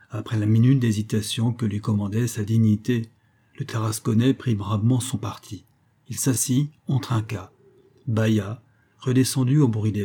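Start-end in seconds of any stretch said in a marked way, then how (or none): none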